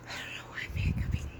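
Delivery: quick hushed whisper. Real, not acted. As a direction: whisper so nobody wakes. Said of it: "I'm gonna wake everybody up".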